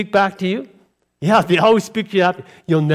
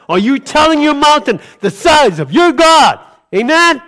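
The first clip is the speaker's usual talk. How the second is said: preaching shout; 7 semitones higher, 6 dB louder than usual. Real, not acted. Preacher's climax.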